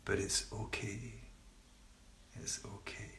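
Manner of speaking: hushed comfort, fading to a whisper; soft reassurance. Real, not acted. Teacher reassures group about a bumpy phase, softly comforting. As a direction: soothe softly, letting it fade away. Repeats her comforting mantra to meditators.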